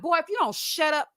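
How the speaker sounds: exasperated retort. Acted. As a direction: tell him off, half in jest. Her acted retort to him.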